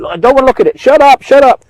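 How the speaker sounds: hammed-up shut up of a denier; imitating someone refusing to look; mock whiny denial. Denier's reply.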